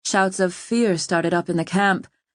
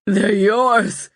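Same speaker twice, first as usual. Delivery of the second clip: louder, bitter retort; snarled; 3 semitones higher, 5 dB louder than usual. A narrator's reading. Defeated king handing over the crown, snarling. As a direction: spit it out in defeat. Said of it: "the king snarled".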